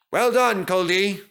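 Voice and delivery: high and loud, beaming approval; hearty praise. Retold or imitated. Inspector praising Culdee after the test, high hearty praise. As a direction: congratulate him warmly.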